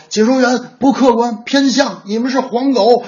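high-pitched heckling fan; mock outrage. Barça fan abusing commentators in comments, mock outrage. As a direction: hurl it like an indignant football fan.

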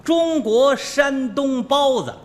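slow, loud, drawn-out shop sign; drawn-out sign reading. Acted. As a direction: read the sign out slowly and grandly. (慢慢拖长念招牌)

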